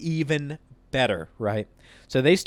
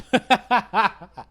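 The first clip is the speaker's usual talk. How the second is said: high, loud burst of laughs; real laughter; 8 semitones higher, 4 dB louder than usual. Real, not acted. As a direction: crack up at your own story. Cracks up at own story.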